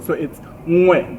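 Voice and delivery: "ngwe" with the nasal ng onset; slow, careful "ngwe" with the ng sound; correct pronunciation. Shown as a demonstration. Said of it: "use your tongue more".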